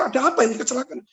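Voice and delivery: mock panic, pitch jumping up; startled. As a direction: reenact a startled passenger. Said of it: "Saya, saya kaget".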